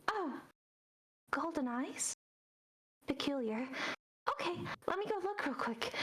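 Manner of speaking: a curious owl, speeding up as it leaves. Acted. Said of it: "And then you hear".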